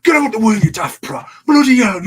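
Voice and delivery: high, loud shouted broad Lancashire rant; Tom Latimer's broad Lancashire. Retold or imitated. Wrestler yelling at a dancing rival in broad Lancashire. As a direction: bellow it in a broad Lancashire accent. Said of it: "broad Lancashire accent, which I'm probably gonna butcher".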